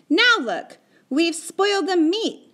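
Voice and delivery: high, loud reproach; angry shout. A narrator's reading. Boy blaming Patrick for the spoiled meat, shouted. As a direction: shout crossly at a friend. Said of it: "he shouted at Patrick".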